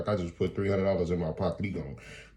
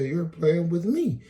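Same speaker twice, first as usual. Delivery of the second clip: disbelief; 11 semitones higher, 5 dB louder, slower than usual. Retold or imitated. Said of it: "I said".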